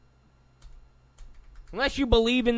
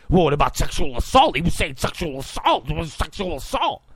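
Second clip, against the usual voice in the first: mock badgering; 3 semitones lower, faster than usual. Mimicking an insistent interviewer.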